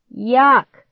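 high, loud cry of disgust; revolted, drawn-out complaint. A narrator's reading. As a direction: recoil loudly from a stink. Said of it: "holding his nose".

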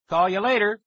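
loud, high sign-off; calling over noise. A narrator's reading. Kid ending call while friend screams, loud call. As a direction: raise the voice to get through.

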